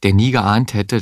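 plain reading voice

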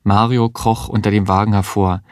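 usual speaking voice

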